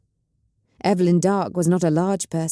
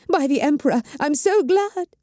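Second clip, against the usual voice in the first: high, hurried fake relief; a high put-on cry of relief; frantic, false gladness; 9 semitones higher than usual. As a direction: gush with forced, frantic joy. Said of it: "frantically, falsely".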